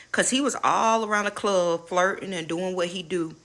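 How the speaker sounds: ordinary talk, nothing marked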